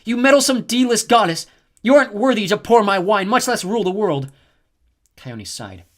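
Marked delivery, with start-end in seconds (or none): 0.0-4.3 high, loud, contemptuous tirade; furious queen. A narrator's reading. Hera scolding the snow goddess, loud scornful rage. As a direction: scorn her from a goddess's height.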